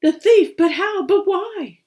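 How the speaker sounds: high, loud stunned question; shocked. Acted. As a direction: badgers stunned by the confession. Badgers stunned by the confession.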